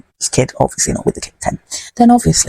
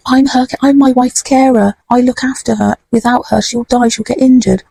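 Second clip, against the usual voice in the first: pleading man; 7 semitones higher than usual. Retold or imitated.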